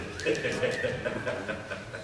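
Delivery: soft, breath-only laugh; low private chuckle of pleasure; quiet chuckle. Real, not acted. Preacher pleased that it all comes together, soft chuckle.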